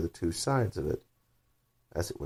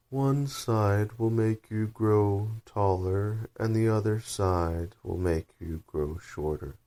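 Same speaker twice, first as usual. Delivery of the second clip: very slow, drawn-out creature voice; caterpillar voice; 3 semitones higher, slower than usual. Acted. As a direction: drawl it like a lazy, stern caterpillar. Caterpillar remarks leaving.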